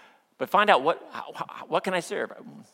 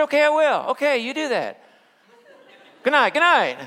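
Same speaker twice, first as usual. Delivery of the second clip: higher, louder huffy sign-off with a laugh; huffy good night; 11 semitones higher, 7 dB louder than usual. Retold or imitated. Husband storming off to the couch, huffy. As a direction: slam the door on the argument with words. Ending the fight, going to couch.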